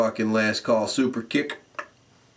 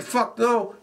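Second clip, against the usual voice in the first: high, scoffing MVP refusal; mock refusal; 10 semitones higher than usual. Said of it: "M V P's like".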